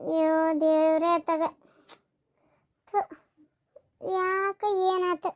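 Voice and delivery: slow, hushed fretting; worried dismay. Acted. Mother finds her daughter burning with fever, softly dismayed. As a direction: fret softly and slowly over a sick child.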